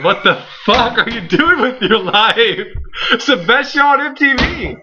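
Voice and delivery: high, incredulous hype; mock outrage. Host scolding listeners who don't know his favorite show, mock-outraged. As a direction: berate them playfully, pitch climbing high.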